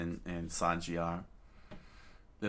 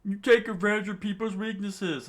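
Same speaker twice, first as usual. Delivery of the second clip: high, loud accusing yell; 14 semitones higher, 8 dB louder than usual. A narrator's reading. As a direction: yell it at her in anger. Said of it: "another yelled out".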